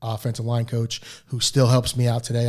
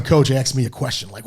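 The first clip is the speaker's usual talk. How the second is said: normal talking voice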